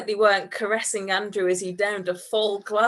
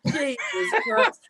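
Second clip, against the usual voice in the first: laughing; slower than usual. Real, not acted. Cracking up at own story.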